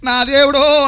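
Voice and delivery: high, loud mock wailing at a corpse; mock lament cried out high and loud; mock funeral wail. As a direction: ham up a mourner's cry. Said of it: "శవం దగ్గర ఏడిచినట్టు కాదు".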